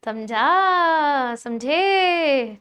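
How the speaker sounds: very slow, stretched checking question; drawn-out check. Real, not acted. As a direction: stretch it out as a teacher checking the class. Teacher checking students understood. (बहुत खींचकर पूछना, समझे?)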